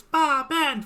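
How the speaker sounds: high little granny voice; tiny old lady voice. Retold or imitated. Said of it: "A tiny little old lady voice".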